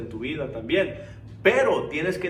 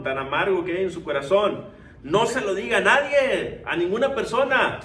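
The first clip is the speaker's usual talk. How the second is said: raised, urgent exhortation climbing at the end; forceful preaching; 3 semitones higher, 5 dB louder than usual. Real, not acted. Preacher tells believers not to confide in people, preaching forcefully. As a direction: press the warning on the congregation.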